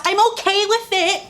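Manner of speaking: high, forced cheerfulness; mock compliance. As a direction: fake being fine. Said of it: "I'm supposed to just be".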